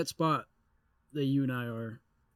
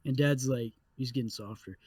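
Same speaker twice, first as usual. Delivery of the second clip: ordinary talk, nothing marked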